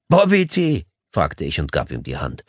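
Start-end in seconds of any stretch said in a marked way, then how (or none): 0.1-0.8 high, mocking echo of the name; mocking disbelief. Lawyer mockingly echoes the visitor's name, scoffing. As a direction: toss the name back, scoffing. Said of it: "Ich lachte ihm direkt ins Gesicht".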